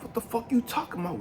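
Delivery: retold high-pitched indignation. Frustrated man answering God's question, indignant. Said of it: "I'm getting more frustrated".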